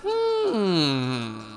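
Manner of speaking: long sliding doubtful hmm; drawn-out suspicious hmm; skeptical hum. Acted. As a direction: hum as if weighing a theory.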